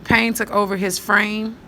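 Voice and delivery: usual speaking voice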